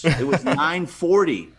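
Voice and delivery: ordinary delivery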